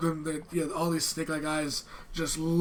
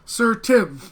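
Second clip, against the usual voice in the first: Sir Tim, louder and higher, insisting; the girl insists on her name, louder and higher; 6 semitones higher, 11 dB louder than usual. Acted. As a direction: repeat the name firmly.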